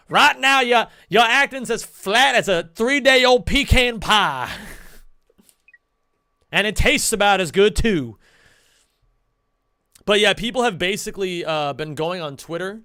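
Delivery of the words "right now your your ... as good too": an old-timey Southern blowhard critiquing acting, louder; acting teacher as Foghorn Leghorn. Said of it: "my acting teacher goes".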